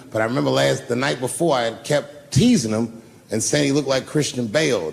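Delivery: ordinary delivery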